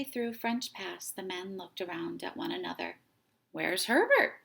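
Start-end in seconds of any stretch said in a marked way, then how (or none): 3.5-4.4 worried question. A narrator's reading. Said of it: "one of them asked".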